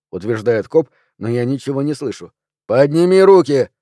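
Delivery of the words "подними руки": loud, high, forceful order; police command. A narrator's reading. Policeman repeating hands-up order, loud and high. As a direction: shout the order at a suspect.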